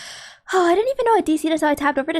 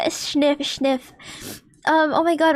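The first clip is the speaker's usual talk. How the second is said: ordinary talk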